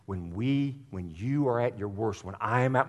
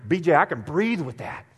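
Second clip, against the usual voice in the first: ordinary talk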